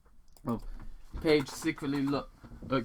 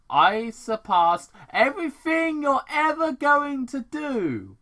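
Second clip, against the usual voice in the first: much higher, louder arrogant boast; shrill villain boast; 14 semitones higher, 9 dB louder than usual. Acted. Robotnik boasting to Tom that he surpassed him, high and loud. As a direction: crow over him like a genius.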